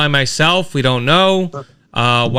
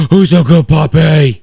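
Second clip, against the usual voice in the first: talking to a dog; 2 semitones higher, 7 dB louder than usual. Real, not acted. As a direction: coo at the puppy.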